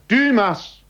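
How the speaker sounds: very high, loud cry of a name; desperate shout. A narrator's reading. Alone at the bay, shouting for Dymas, desperate. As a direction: call out as if nobody will answer. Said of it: "rief er wieder".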